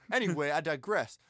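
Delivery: ordinary talk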